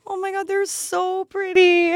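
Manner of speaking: very high excited squeal; gushing squeal. Acted. Girl opening up a gift of heels, gushing squeal. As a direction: squeal with delight over a gift. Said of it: "I'm like".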